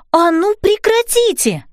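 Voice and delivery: high, drawn-out order to stop; scolding yell. A narrator's reading. Fairy mother to rowdy napkins, scolding yell. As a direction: call out sternly, pitched well up. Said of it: "закричала на них мамочка-фея".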